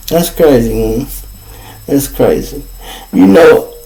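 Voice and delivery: ordinary talk, nothing marked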